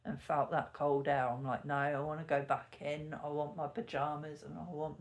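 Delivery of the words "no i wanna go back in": ordinary talk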